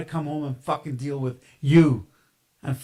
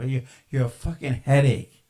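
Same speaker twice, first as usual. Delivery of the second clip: normal talking voice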